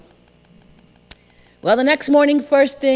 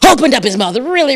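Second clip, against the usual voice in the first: plain reading voice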